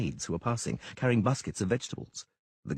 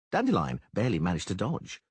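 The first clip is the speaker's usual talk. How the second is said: plain reading voice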